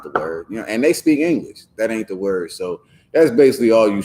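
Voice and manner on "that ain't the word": usual speaking voice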